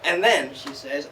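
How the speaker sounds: ordinary talk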